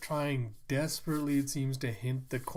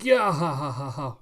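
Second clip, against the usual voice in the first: hammed-up belly laugh, loudest at the start; mocking guffaw; 2 semitones higher, 6 dB louder than usual. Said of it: "just continue laughing".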